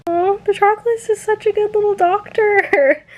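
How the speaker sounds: cooing fondly. Real, not acted. Gushing over favourite character.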